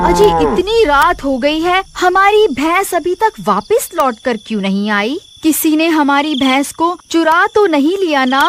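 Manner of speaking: wife asks her husband where the buffalo is, worried. Acted. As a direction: fret to your husband about the missing buffalo.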